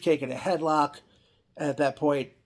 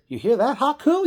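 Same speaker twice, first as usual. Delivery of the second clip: higher, louder needling call to Haku; hammed-up interviewer stirring trouble; mock goading taunt; 11 semitones higher, 7 dB louder than usual. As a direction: egg him on, voice climbing to a squeak on the name.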